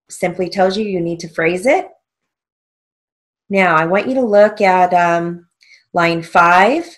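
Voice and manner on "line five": ordinary talk, nothing marked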